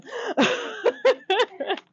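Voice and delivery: high peals of laughter; helpless high giggle; giggling laugh. Real, not acted. Teacher sharing a joke with the class, laughing.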